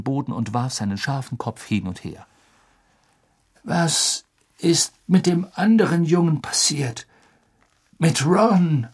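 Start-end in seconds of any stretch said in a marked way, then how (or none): 3.6-8.9 higher, louder anxious man; worried Sirius. A narrator's reading. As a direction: ask with concern.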